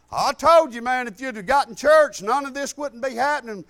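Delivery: loud, preachy told-you-so scolding; scolding yell. Retold or imitated. Bradley scolds the jailed man, a loud told-you-so. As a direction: rub it in, loudly.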